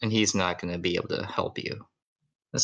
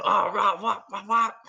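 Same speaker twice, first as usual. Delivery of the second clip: much higher frantic mom voice; hammed-up panicked wife; 10 semitones higher than usual. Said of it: "It's like".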